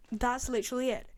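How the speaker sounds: normal talking voice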